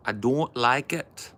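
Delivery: high, slow mock Scouse; badly faked Scouse, rising at the end; attempted Scouse accent. Having another go at a Scouser, attempted Scouse accent. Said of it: "I can't do Scouse".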